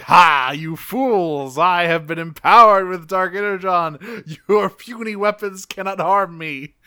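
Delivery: hammed-up evil boast, opening on a loud HA; Starscream. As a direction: crow it like a cartoon villain. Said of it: "Starscream's like".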